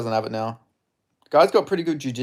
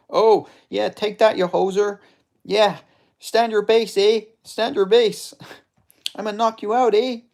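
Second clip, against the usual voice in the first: higher-pitched hammed-up Canadian accent; Albertan hoser; 8 semitones higher, 3 dB louder than usual. Gamer voicing his fighter's taunts in a high Canadian accent. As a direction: play the fighter as a trash-talking Canadian hoser. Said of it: "I'm doing that voice for this guy".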